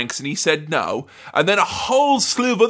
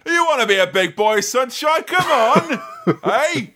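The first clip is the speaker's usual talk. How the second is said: hammed-up Cockney heavy, pitched higher and laughing at the end; aggressive Cockney; 8 semitones higher than usual. Zoltar taunting the kid who wants to be big, mock Cockney menace. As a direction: goad him like a London geezer, jokey and pushy. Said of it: "a very aggressive Cockney man be like".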